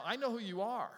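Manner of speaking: mild acted outcry; crying out. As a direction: cry it out in sudden realization, without shouting. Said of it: "Nathanael cries out".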